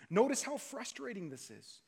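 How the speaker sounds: ordinary delivery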